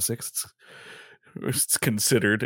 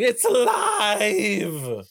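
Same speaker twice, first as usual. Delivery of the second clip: slow, much higher stretched shout of triumph; mad scientist cry; 10 semitones higher, much slower than usual. Shown as a demonstration. Warming up, host bellows the mad doctor's cry. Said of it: "You gotta commit".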